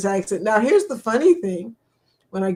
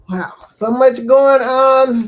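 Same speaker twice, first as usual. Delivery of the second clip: wow; 2 semitones higher, 8 dB louder, slower than usual. Real, not acted. Reacting to sad chat news.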